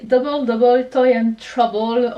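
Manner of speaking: slow, self-mocking English accent on the title. Shown as a demonstration. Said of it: "avec mon super accent anglais".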